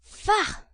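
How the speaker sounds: high, disgusted scoff; disgusted faugh. A narrator's reading. Beadle disgusted.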